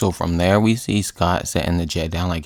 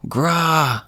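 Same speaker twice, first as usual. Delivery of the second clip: mock zombie snarl; 9 semitones higher than usual. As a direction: imitate a zombie lunging to bite. Zombie biting Tony.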